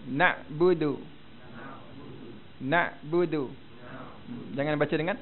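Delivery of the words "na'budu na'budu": "Na'budu" with a gentle catch at the ain; moderate ain. Shown as a demonstration. Teacher models for students, moderate ain in "Na'budu". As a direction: keep the throat sound in "Na'budu" unforced. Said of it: "Jangan tekan sangat huruf ain".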